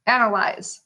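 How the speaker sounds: ordinary talk, nothing marked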